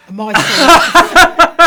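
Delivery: loud laughing burst; laughing exclaim. Real, not acted. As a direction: blurt it out through laughter. Laughing about the dinner scene.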